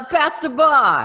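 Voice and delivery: a hammed-up New York accent, high and loud; mock New York accent. Joking about the bar in a mock New York accent. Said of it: "so many New Yorkers here".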